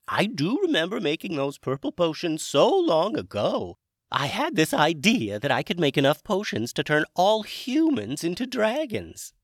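high, loud dragon character voice; Hamish dragon voice. Acted. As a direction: play a chirpy, high-pitched dragon wizard. Hamish speaks.

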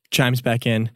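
usual speaking voice

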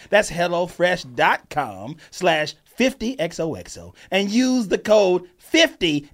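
slow, spelling out every code; slow emphatic ad read. Shown as a demonstration. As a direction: drill the code into them slowly. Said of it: "Do you understand what I said?".

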